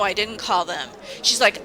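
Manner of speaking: ordinary talk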